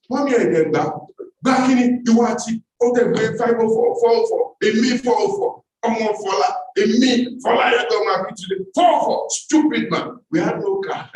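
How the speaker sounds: high, loud mocking singsong; mocking mother. Mother mocking the offered car, high scornful voice. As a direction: ridicule the bribe.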